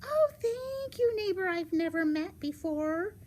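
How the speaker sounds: put-on high, gushing little girl. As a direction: play a sweet, trusting girl, high and loud.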